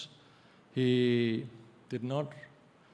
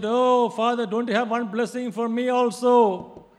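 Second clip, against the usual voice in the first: high, loud pleading to father; pleading cry; 8 semitones higher, 9 dB louder than usual. Retold or imitated. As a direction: beg as if crying out. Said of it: "he said".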